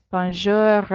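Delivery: Bonjour with a light throaty growl on the final r; r grasseyé sur bonjour; growly French r. Shown as a demonstration. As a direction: end Bonjour on a little growly r. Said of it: "It's just a light growl on the end".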